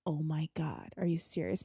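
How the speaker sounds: quiet, half-whispered aside; hushed disbelief. Retold or imitated. Listener reacting privately to Matt's story, hushed disbelief. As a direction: say it under your breath in disbelief.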